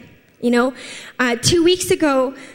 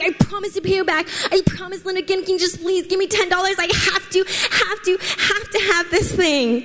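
whiny little sister begging; 6 semitones higher than usual. Retold or imitated. Little sister begging Linda for money, higher whiny plea. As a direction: wheedle for ten dollars like a spoiled little sister. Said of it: "She'd be like".

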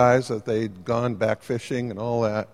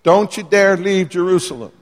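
higher, louder stern order; stern command; 6 semitones higher, 5 dB louder than usual. Retold or imitated. Jesus to disciples, a stern raised command. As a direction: lay down the law, no arguing. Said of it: "He's saying".

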